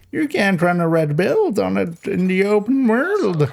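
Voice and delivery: put-on high, slow cackly old timer; wheezy old gold miner drawl; old prospector voice. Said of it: "we went with the old prospector voice".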